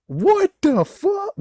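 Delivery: high, slow, drawn-out what the fuck; stunned, disbelieving squeal; disbelief. Real, not acted. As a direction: let the outrage squeak up high. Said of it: "disbelieving exclamation".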